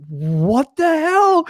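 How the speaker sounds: high, drawn-out baffled reaction; acted disbelief. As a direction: stretch it out high, incredulous.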